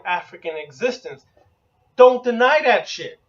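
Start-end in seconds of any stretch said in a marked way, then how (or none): none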